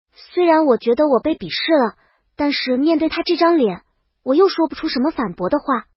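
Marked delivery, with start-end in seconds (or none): none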